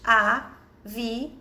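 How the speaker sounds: "Avião" slowly as a, vi, ão; syllable by syllable. Shown as a demonstration. Teacher separating the word into little pieces, slowly syllable by syllable. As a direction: split "Avião" into three held pieces. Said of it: "vamos separar em pedacinhos".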